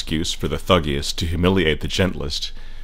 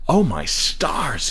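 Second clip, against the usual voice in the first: slow, higher gasp of amazement; 5 semitones higher, much slower than usual. A narrator's reading. Father exclaims with a mild oath, slow gasp. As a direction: draw it out in amazement. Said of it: "he would gasp".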